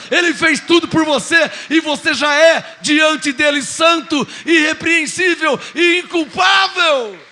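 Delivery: loud, high fervent preaching; preaching at full force. Acted. Preacher shouting grace to the congregation.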